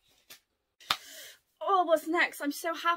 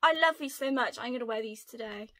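usual speaking voice

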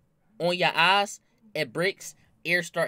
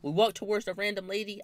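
normal talking voice

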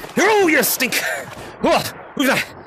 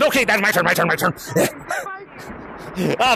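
ordinary talk

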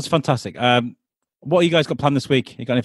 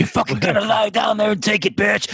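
mock demon; 7 semitones higher than usual.

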